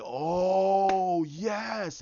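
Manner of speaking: long, high, loud "oh" of delight; drawn-out elated whoop, acted; thrilled cheer. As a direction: act out a fan cheering the big reveal. Voicing a thrilled viewer's reaction.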